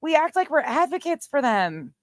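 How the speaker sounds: sarcastic echo. Retold or imitated.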